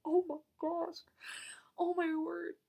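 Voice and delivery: high, breathless fangirl disbelief; overwhelmed. Retold or imitated. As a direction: gasp it high, overcome with amazement. Retelling her overwhelmed reaction.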